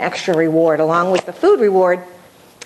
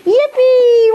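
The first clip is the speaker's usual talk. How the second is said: squealing, laughing yippee, much higher and louder; celebrating cheer; 4 dB louder, much slower than usual. Shown as a demonstration. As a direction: cheer like she just won. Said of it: "Ask, receive, and celebrate".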